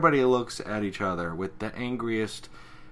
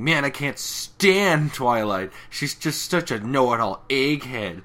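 scornful Rainbow voice; 4 semitones higher, 6 dB louder, slower than usual. Retold or imitated. Rainbow Dash voiced in retelling.

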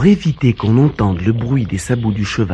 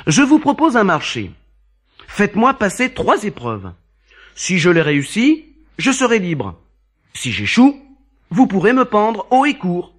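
put-on bold young man; 9 semitones higher than usual. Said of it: "répondit le jeune homme hardiment".

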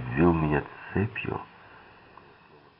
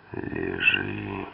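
low, grumbled command; growled order; 8 semitones lower, 3 dB quieter than usual. A narrator's reading. Dedrick pushes the captive back down, low growled order. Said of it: "проворчал Дедрик".

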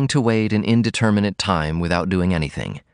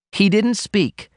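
put-on a young woman blurting it out, raised high; blurted out; 12 semitones higher, slower than usual. A narrator's reading. Daughter confessing to her mother, blurted high. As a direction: she blurts it out before she can stop. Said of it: "she blurted out".

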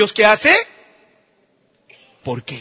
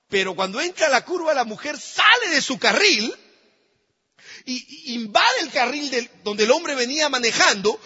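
ordinary talk, nothing marked

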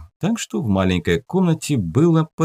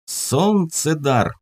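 «Солнцедар» read slowly and higher; 6 semitones higher, much slower than usual. Acted. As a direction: stretch out «Солнцедар» like reading a label. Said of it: "громко прочитал он этикетку".

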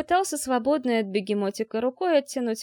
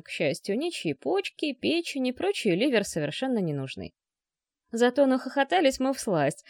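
usual speaking voice

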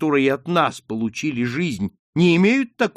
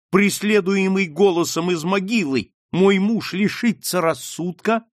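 higher, louder, rising urgency; impassioned plea; 5 semitones higher, 4 dB louder than usual. Acted. Daughter's mounting plea to mother.

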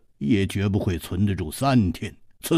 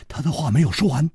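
usual speaking voice

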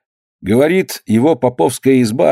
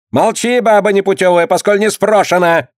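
angry; 7 semitones higher than usual. Acted. Clerk shouts at the woman.

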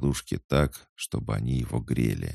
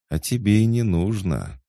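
lightly purred, higher and louder; purring; 8 semitones higher, 6 dB louder than usual. A narrator's reading. Girl reassuring the boy he needn't, lightly purring. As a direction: purr it as a lifted reassurance. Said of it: "промурлыкала девушка".

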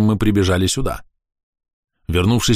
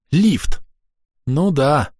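high, sudden cry of discovery; surprised exclamation; 6 semitones higher, much slower than usual. A narrator's reading. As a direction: blurt it out, amazed. Discovery of a lift.

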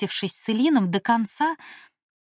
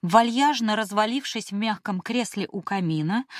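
ordinary delivery, nothing marked

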